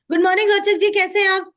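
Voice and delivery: loud, high-pitched sales greeting; loud tonality. Shown as a demonstration. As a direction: hail the customer like a noisy market seller. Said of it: "अचला का tonality है loud". (ऊँची, ज़ोरदार आवाज़ में)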